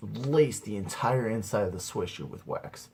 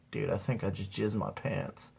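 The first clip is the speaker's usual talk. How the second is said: low, softer, dazed admission; quiet stoned confession; 3 semitones lower, 8 dB quieter than usual. Acted. Stoned teen confessing to his buddy, quietly. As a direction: murmur it after a sigh, dazed.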